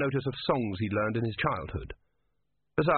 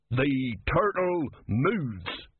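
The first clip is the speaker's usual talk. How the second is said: very slow, higher-pitched pronouncement; solemn password; 4 semitones higher, much slower than usual. Acted. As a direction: draw out each word meaningfully. Secret password given to philosopher.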